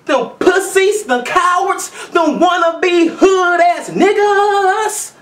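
escalating mock tirade, ending in a high shout; building yell. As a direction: work yourself up into a slow shout. Retold rant building to a yell.

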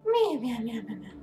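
high playful meows at a cat; meowing. Pretend. Player meowing back at a game cat, playful high meows. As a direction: mew like a kitten, high then trailing off.

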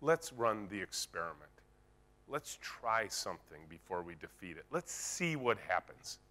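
calmly disarm the naysayer; calm reassuring. Shown as a demonstration. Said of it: "you just look them in the eye and say".

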